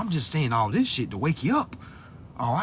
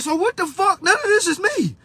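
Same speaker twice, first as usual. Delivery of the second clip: high-pitched retold outrage; affronted, rising what-on-earth reaction; indignant protest; 13 semitones higher than usual. Retells indignant reaction to definition.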